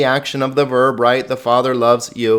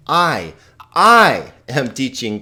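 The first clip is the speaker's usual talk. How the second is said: "I" said alone, then punched louder before "am teaching"; emphatic repeated I; 4 dB louder, slower than usual. Shown as a demonstration. Teacher demonstrates the emphasizing pronoun to students, stressed "I". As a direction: slowly hammer the second "I", then carry on. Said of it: "it's emphasizing".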